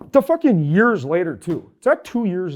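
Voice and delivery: loud exasperated outburst; angry outburst. Real, not acted. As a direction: blow up over the contract. Ranting about Hayward contract.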